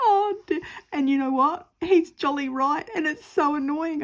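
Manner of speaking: high, loud, still laughing; exasperated amusement; giggly annoyance at husband being right; laughing exasperation. Real, not acted.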